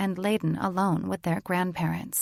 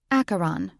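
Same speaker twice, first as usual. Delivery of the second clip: ordinary delivery, nothing marked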